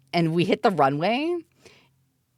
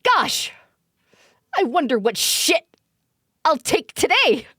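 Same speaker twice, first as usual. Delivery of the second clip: mock indecisive customer; 6 semitones higher than usual. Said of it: "So it's like".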